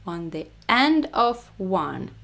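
usual speaking voice

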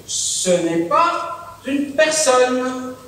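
very slow, drawn-out words; slow insistence. Acted. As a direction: spell it out slowly, word by word. Insisting the helper is no person.

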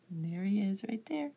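whispered aside; whispering. Real, not acted. Aside showing the picture.

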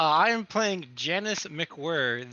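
ordinary talk, nothing marked

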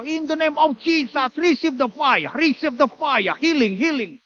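chanting prayer in an imitated African accent. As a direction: imitate an African preacher praying for healing. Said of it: "orang Afrika".

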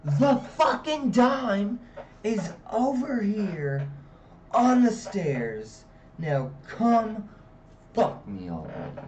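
high, loud, slow furious yell; angry girlfriend screaming at him. Retold or imitated.

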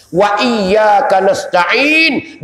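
very slow, drawn-out recitation; Quran recitation. Acted. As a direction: intone the verse with long vowels. (bacaan ayat yang dipanjangkan perlahan)

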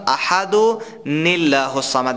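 احد و لله with an added n sound before لله, slow; careful tajwid demo; correct nun qutni. Shown as a demonstration. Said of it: "کسایی که تجوید میفهمن یا درست از استاد خواندن".

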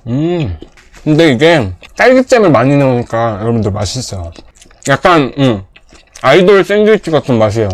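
speaking between bites; talking while chewing. Real, not acted. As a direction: mouth full of burger. Seen 2: Hand covers chewing mouth; bites again at 8.6, cheeks full after.

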